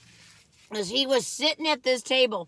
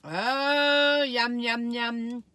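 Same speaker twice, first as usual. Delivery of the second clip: delighted yum; 3 semitones higher, 3 dB louder, much slower than usual. Real, not acted. Tasting nachos, delighted.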